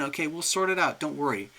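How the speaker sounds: ordinary delivery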